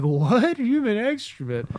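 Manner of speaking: high, loud feigned shock; mock disbelief. Prankster echoing brother's report, mock-shocked. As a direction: echo it back in hammed-up astonishment.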